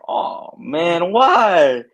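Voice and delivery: rising, drawn-out mock protest; mock dismayed whine. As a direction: play up the dismay, pitch climbing. Acting out own dismayed reaction.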